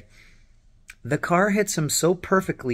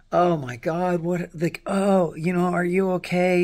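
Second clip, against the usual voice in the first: retold alarm; 6 semitones higher, 3 dB louder than usual. Acts out the alarmed driver.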